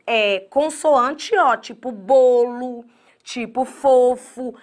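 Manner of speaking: drawn-out, slow over each word; patient teacher slowing way down; slow teaching demo. Shown as a demonstration. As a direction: spell out the O sound slowly for the class. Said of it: "terminação O".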